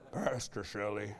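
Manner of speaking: deep, soft impression of Samson; Samson's deep voice. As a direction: rumble it low like Samson. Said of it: "sound like Samson".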